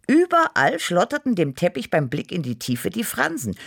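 usual speaking voice

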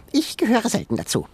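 high, smug little animal voice; tiny creature voice. A narrator's reading. Sengi correcting Ben, high creature voice. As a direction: quip like a cheeky critter.

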